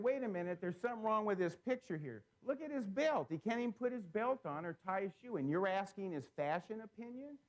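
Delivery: he's telling a coworker there's something wrong with this picture, high and incredulous; retold incredulous outburst.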